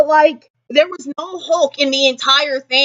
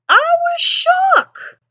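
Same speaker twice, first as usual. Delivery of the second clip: high squeaky shook; much slower than usual. Real, not acted. Stunned reaction.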